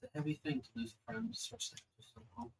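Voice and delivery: a gently understated goddess, with long pauses between phrases; celestial being. Acted.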